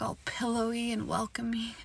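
whispered dirty talk; sultry whisper. Acted. To her partner, sultry whispering. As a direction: breathe it into his ear.

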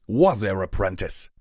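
loud, gruff hail; yelling. A narrator's reading. Hammer Chain stops Rarity entering a store, yelling. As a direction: shout it across to an apprentice. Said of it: "Hammer Chain yelled".

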